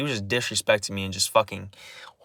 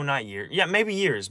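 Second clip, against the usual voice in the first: ordinary talk, nothing marked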